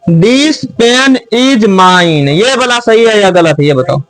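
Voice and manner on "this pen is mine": each word read out very slowly and clearly; slow dictation. Shown as a demonstration. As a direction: read it slowly so the class can check it. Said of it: "फिर है". (बहुत धीरे, साफ़-साफ़ बोलकर)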